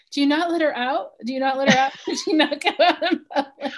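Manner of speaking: high, loud laughing mock question; laughing retort. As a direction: fire it back while laughing.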